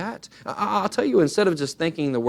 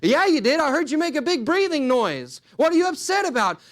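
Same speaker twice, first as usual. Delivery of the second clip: high, louder accusation; accusing; 11 semitones higher, 3 dB louder than usual. Retold or imitated. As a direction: pin the blame on them. Accusing spouse in quarrel.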